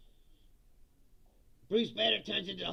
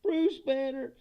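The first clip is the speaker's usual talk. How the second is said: higher mock voice announcing the name; hammed-up reply; 5 semitones higher, 4 dB louder, slower than usual. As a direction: answer like a comic-book character, played up. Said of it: "And he's like".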